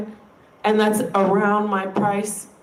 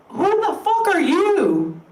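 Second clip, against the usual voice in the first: real, higher-pitched outrage; indignant; 7 semitones higher than usual. Real, not acted. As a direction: challenge them, pitch jumping up. Indignant rant at fake-callers.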